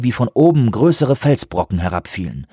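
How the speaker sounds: usual speaking voice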